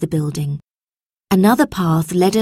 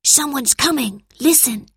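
put-on higher boy voice, hushed urgency; lightly voiced boy, urgent; 7 semitones higher than usual. A narrator's reading. Robert warns Lucy that someone comes. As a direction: warn her quickly.

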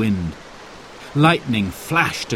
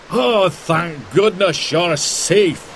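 relieved cry; 6 semitones higher, slower than usual. A narrator's reading. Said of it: "cried Mister Clark".

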